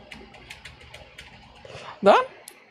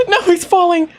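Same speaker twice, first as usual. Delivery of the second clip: gasp, then a high, loud no; alarmed that he's falling, half laughing; 11 semitones higher, 5 dB louder than usual. Real, not acted. Player gasping as the character falls, high and alarmed.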